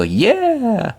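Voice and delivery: put-on very high toddler squeal of joy. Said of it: "my two-year-old squeal".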